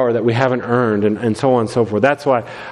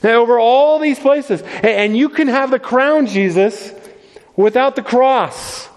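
high, coaxing tempter's voice, raised well above his own; a pastor voicing Satan offering Jesus the crown, high and coaxing; tempting Satan; 9 semitones higher than usual. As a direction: pitch it up and sweet-talk like the devil dangling a prize. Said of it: "He's saying".

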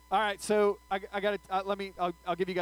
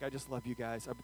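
sheepish excuse; 8 semitones lower, 12 dB quieter than usual. Retold or imitated. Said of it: "I'm like".

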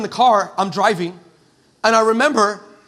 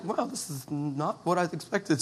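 soft, slow, low aside; underwhelmed wow; 4 semitones lower, 11 dB quieter, slower than usual. Retold or imitated. Visitor looking round a normal house, quietly underwhelmed. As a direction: say it quietly to yourself.

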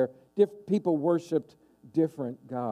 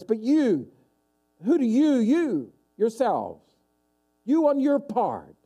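each you pushed higher and louder; emphatic question; 5 semitones higher, 4 dB louder than usual. Retold or imitated. Jesus pressing his disciples, emphatic and raised.

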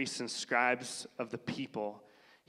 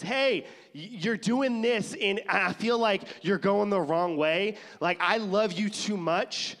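high, louder pleading from a loved one; caring callout; 7 semitones higher, 6 dB louder than usual. Retold or imitated. Spouse calling you out, high and earnest. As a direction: lightly voice a worried spouse.

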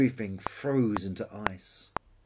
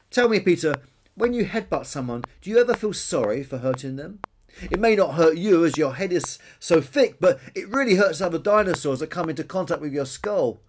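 raised, loud, earnest lecturing; reproving brontosaurus Billy; 6 semitones higher, 7 dB louder than usual. A narrator's reading. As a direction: appeal earnestly to a bully's conscience.

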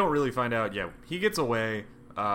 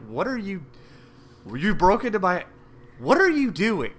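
flustered vampire; 8 semitones higher than usual. Retold or imitated.

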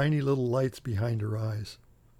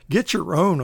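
snapped louder at the leech; snarled back; 4 semitones higher, 6 dB louder than usual. Acted. Clown snarling back at the leech, louder. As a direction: bite the words back at it. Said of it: "I snarl back in leech speak".

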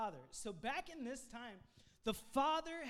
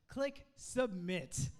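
slow deadpan; 5 dB louder, much slower than usual. Acted. Joke about ordering a husband.

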